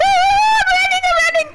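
very high, loud squealing; hammed-up squeal of running; excited squeal. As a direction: squeal like a thrilled child. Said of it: "I'm like".

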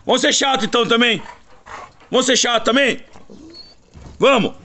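high-pitched scolding of pestering dogs. Real, not acted. Owner to dogs being annoying, scolding.

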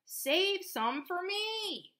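high, slow drawn-out plea; farmer pleading. A narrator's reading. Farmer begging the horse, slow high plea. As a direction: stretch it out begging. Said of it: "cried the farmer".

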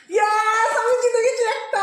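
excited. Real, not acted. Cheering after winning the round.

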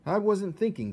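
lightly voiced worried king. A narrator's reading. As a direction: play the fretful king. King voiced in read story.

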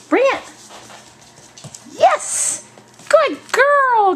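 high, bright praise for the dog; squeaky praising a dog. Real, not acted.

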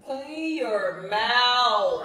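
drawn-out loud command; slow loud scolding. Real, not acted. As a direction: stretch it out, scolding. Scolding after messy eating.

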